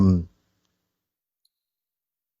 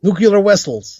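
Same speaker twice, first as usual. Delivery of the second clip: put-on Russian accent; 7 semitones higher than usual. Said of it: "You have to do Russian voice now".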